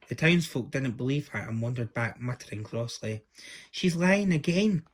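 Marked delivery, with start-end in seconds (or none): 3.7-4.9 upset cry. Acted. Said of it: "cried Prince Tom".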